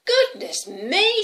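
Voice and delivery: high, loud drawn-out amazement; astonished little girl. Acted. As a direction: exclaim slowly in big surprise.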